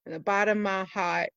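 very low, soft confession; grave confession. Acted. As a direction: drop to a heavy hush.

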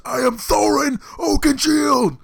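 slow, high, loud heroic yell; slow drawn-out Thorin battle cry; yelled at top of lungs. Retold or imitated. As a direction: bellow the name like a drunk hero. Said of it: "just fucking yelling at the top of my lungs".

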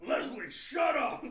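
slow, loud laughing yell; hammed-up angry neighbor; mock shout. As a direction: holler at the neighbor through laughter.

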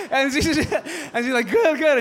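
laughing high and loud; gleeful laughing. Retold or imitated. Wife laughing with delight at the revenge plan, gleeful. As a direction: cackle with glee at the plan.

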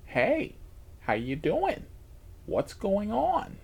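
lightly voiced passenger, rising and falling pitch; a hint of a clueless man's voice; imitated oblivious guy. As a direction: imitate his casual greeting, drawing out the end. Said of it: "That's how he sounded".

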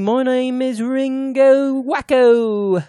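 high, drawn-out mock Liverpool voice. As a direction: stretch it out high and slow, far from your own voice. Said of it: "Does that sound like him?".

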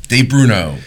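ordinary talk, nothing marked

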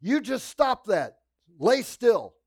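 loud, high angry order; mean command. Retold or imitated. Orderly ordering the patient to lie still, loud and angry. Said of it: "and he was so mean".

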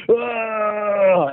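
long, high mock scream; drawn-out high yell, imitating someone losing it; imitated scream. Caller imitating a crazed moviegoer at a fight scene, mock scream. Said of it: "screaming, he's like".

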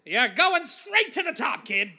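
high, loud, drawn-out hype; hammed-up promoter holler; mock showbiz promoter. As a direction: shout it like an old-time talent agent. Plays old-time showbiz promoter.

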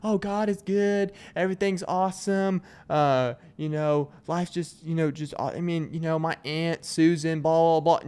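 cheerful oversharing Southern small talk; Southern oversharer. Retold or imitated. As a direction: gush like a chatty Southern neighbor. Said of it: "they'll tell you everything".

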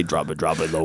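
sing-talk it down low; deep bass voice. Pretend. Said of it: "you could say".

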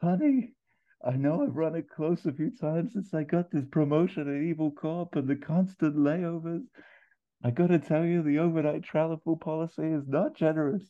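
high, strained character voice; wounded man pleading high down the phone; Mustafa. Acted. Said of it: "Ferrell as Mustafa at the wreckage".

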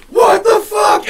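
high loud alarmed yell; panicked shout. Retold or imitated. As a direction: yell it while dangling. Friend stuck on rock wall.

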